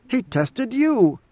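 high, slow, stunned exclamation; the truth dawning on him all at once; sudden realization. A narrator's reading. Idaho realizing what Mineo went through, stunned. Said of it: "overcome by sudden realization".

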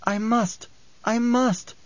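resist sleep in a small high voice; desperate drowsy Alia. A narrator's reading. Alia's voice, drifting to sleep.

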